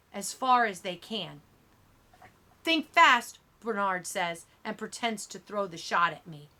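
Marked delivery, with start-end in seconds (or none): 2.6-3.4 high, loud sudden warning; quick mock-warning call; sudden taunt. A narrator's reading. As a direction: call it out to make someone flinch. Bully pretends to throw the shot.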